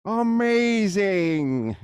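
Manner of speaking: slow, stretched mock-amazed reveal; kids party magician. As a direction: savour the word like a showman.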